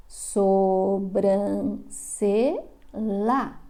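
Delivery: Sobrancelha said very slowly with a plain la sound, sobrance-la; wrong reading, stretched. Shown as a demonstration. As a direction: drag out Sobrancelha ending in la instead of lha. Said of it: "E não é sobrancelha, é sobrancelha". (leitura errada e arrastada de Sobrancelha, com la no final)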